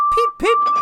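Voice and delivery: very high, loud train peep; whistle peep. A narrator's reading. Edward whistling at the station, high and loud. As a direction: pipe it like a tiny whistle. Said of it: "whistled Edward".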